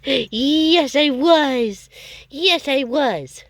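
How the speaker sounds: high cooing voice to a dog; pet talk. Real, not acted. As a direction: coo at the dog.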